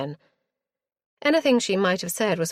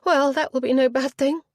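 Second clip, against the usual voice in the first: high, louder toss-of-the-head retort; petulant girl; 9 semitones higher, 6 dB louder than usual. A narrator's reading. Spoilt girl dismissing her father's fate, petulant. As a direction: flounce it out. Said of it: "Richenda's head tossed".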